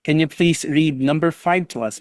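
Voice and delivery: usual speaking voice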